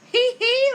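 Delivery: loud, high hee-hee laugh. A narrator's reading. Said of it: "laughs the whole entire family".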